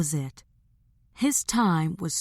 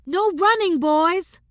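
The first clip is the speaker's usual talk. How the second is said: calling out; 13 semitones higher, 6 dB louder than usual. Acted. Mother calls after running boys.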